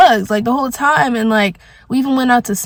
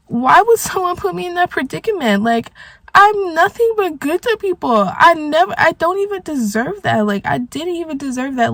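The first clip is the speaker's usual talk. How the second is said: high, hurt plea on the verge of tears; close to crying; 6 semitones higher than usual. Real, not acted. Venting about being embarrassed by partner, close to crying. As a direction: plead your case, voice pitched up, real upset. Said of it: "I might cry now".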